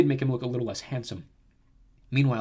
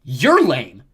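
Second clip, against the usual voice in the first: loud, indignant comeback; angry retort; 2 semitones higher, 12 dB louder than usual. A narrator's reading. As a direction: throw the insult back angrily. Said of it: "The nymphs muttered angrily".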